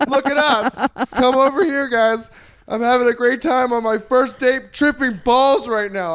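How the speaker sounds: high, loud sarcastic glee; mock show-off. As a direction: play up loving all the attention, loudly calling everyone over. Sarcastic show-off to onlookers during date's meltdown.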